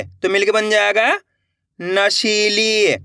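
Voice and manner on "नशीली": नशीली slowly, शी and ली held long. Shown as a demonstration. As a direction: stretch both ee sounds in नशीली. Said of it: "देखो किस तरीके से".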